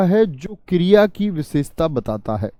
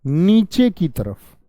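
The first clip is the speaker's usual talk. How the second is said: slow emphasis; much slower than usual. Shown as a demonstration. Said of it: "तो क्या आ गया आपका?".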